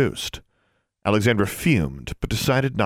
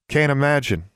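a touch of drawled irony, pitched up; drawling; 6 semitones higher than usual. A narrator's reading. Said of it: "Forbearance drawled".

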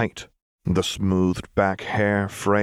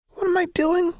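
put-on very high, giddy unhinged girl voice; manic Jinx. A narrator's reading. Jinx toying with Vi, manic and high. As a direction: play her unstable and playful.

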